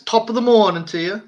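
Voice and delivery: attempted Australian accent. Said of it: "How about this?".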